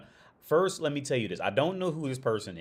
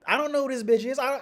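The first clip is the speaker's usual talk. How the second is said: ordinary talk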